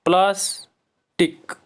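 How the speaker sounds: "Plastic" slowly in two beats, pliers, टिक; syllable by syllable. Shown as a demonstration. Teacher proving there are two syllables, syllable by syllable. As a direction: pause in "plastic" between its syllables. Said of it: "इसमें दो syllable हैं".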